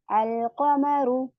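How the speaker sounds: अलकमरु very slow, with the l of अल clearly sounded; clear lam. Shown as a demonstration. Teacher shows the students a moon letter. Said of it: "अलिफ लाम पढ़ा जाएगा".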